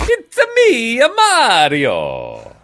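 high, slow cartoon Italian accent; Mario voice. Host doing Mario for co-host, cartoon Italian accent. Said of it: "you sound Italian".

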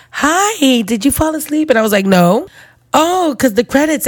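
a nurse chirping innocently; lightly voiced chirpy nurse; sweet nurse voice.

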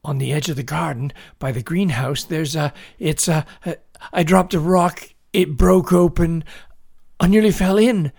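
high, loud, mounting panic; breathless panic. A narrator's reading. Boy telling his dad about buried bones, panicked and gasping. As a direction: blurt it in bursts, climbing to a cry. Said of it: "He gasped for air".

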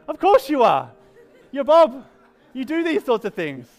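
high, loud retold exclamation; amused exclamation. Pastor retelling his reply to lake-digging Bob, amused exclamation. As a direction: play up the delighted lack of surprise.

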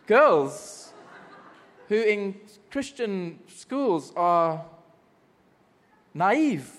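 laughing through the words, stretched out. Real, not acted. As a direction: chuckle while confessing, slowing right down. Laughing at own past.

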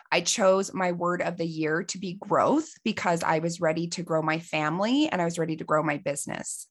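normal talking voice

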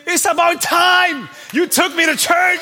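high, loud, exasperated shout; spirit shouting. Retold or imitated. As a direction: belt it like a spirit fed up with waiting. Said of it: "your spirit's saying".

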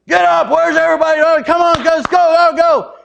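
high loud hurry-up shouts; marching yell. Retold or imitated. Dad storming downstairs yelling at his family to go. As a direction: drive them out like a drill sergeant. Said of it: "Marching down the stairs".

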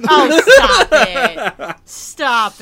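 laughing it out, slow. Real, not acted. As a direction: stop it through laughter. Laughing at friend's reaction.

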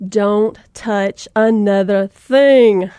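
slow, loud, stern command; stern mom warning. Retold or imitated. Mom warning her kids in Target, slow stern command. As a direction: lay down the law slowly.

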